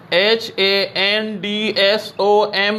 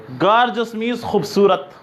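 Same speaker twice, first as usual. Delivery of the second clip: usual speaking voice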